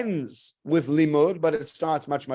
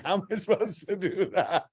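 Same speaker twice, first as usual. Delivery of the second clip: laughing; 4 semitones higher than usual. Real, not acted. Joking with Michael in audience.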